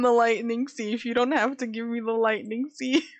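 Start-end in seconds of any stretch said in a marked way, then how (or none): none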